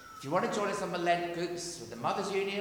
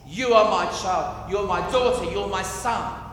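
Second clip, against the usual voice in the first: loud fatherly declaration; 4 semitones higher, 13 dB louder than usual. Acted. Preacher voicing God's love to the congregation, booming. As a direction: proclaim it loudly as the Father.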